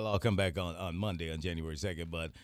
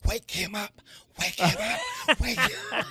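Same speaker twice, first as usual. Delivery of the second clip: urgent hiss through clenched teeth; through gritted teeth; 8 semitones higher, 4 dB louder than usual. Retold or imitated. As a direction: mimic mom whispering through her teeth. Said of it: "Is she talking through her teeth?".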